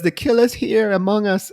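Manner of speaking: put-on high-pitched, half-hearted French accent; bad French accent. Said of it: "such a bad French accent".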